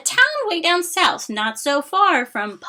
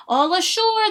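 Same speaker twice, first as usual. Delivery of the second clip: higher, louder ship call; shouted; 7 semitones higher, 6 dB louder than usual. Acted. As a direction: sing out to the whole dock. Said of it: "the men shouted".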